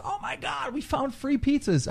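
very high, squeaky mock-excited voice; mocking excited kids. Kids finding pizzas on a car, imitated in high excitement. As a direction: imitate thrilled kids in falsetto.

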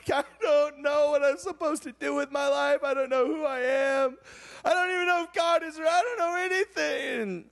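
imitating his weeping teenage self; retold crying. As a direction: pour it out through tears. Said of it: "so I'm weeping, crying".

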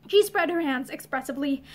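ordinary delivery

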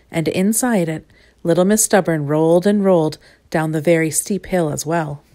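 ordinary delivery

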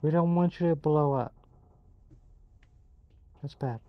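ordinary talk

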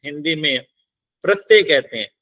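usual speaking voice

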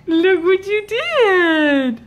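slow, sing-song "look what you did"; playful mock scolding. Real, not acted. Baby pulled curtain down.

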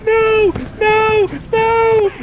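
high panicked no's; scared horror game streamer. Real, not acted. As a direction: panic as it grabs you. Attacked by enemy.

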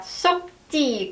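"쏙지" said slowly with a short closed 쏙; clipped sok. Shown as a demonstration. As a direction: say "쏙지" slowly, the s not voiced. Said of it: "쏙는 소흐 발음하지 않고 쏙". ("쏙지"의 s는 발음하지 않고 짧게)

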